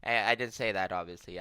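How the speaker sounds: ordinary talk, nothing marked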